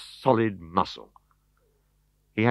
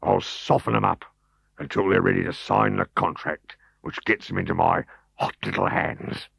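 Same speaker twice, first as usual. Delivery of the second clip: ordinary delivery, nothing marked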